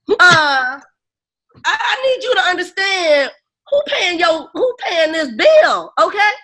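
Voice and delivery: high, loud, exasperated demand; indignant mock outburst. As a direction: put him in his place, voice cracking up. Demanding doctor listen, who pays.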